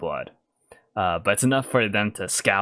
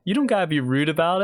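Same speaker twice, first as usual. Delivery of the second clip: quicker, put-on offended voice; huffy retort; 7 semitones higher, faster than usual. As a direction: sulk it out like a hurt bandit. Kidnapper repeats retort.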